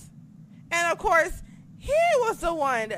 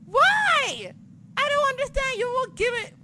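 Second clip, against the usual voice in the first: squeaky high incredulous whine; high-pitched outrage; 13 semitones higher than usual. Acted. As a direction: shriek it in disbelief. Ranting about Braun title.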